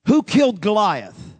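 booming question from God, loud; a loud divine question; strong voice of God. Retold or imitated. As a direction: ask it like thunder from above. God's voice spoke strong inside.

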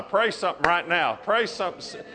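loud, urgent preacher exhortation; preaching at full force. Real, not acted. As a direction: drive the congregation to pray. Urges church to pray aloud.

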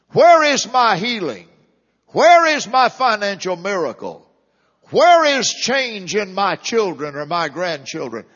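loud, impassioned cry to God; questioning believer. Acted. Preacher voices troubled believers asking God, pleading loudly. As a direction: plead each question out, hitting "where" hard. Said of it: "many could have asked".

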